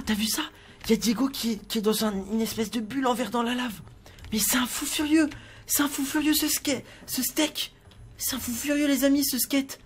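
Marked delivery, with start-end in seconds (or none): 4.3-9.8 higher, incredulous voice; can't believe how mad he is; shocked outrage. Pretend. Shocked at the villain. (indignation incrédule, voix aiguë)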